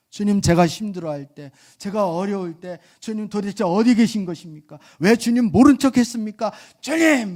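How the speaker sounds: high, loud indignant demand; protesting to the Lord. Retold or imitated. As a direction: demand answers from God, building to a cry. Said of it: "막 이렇게 따졌어요". (주님께 따지는 사람이 점점 높고 크게 항의하듯)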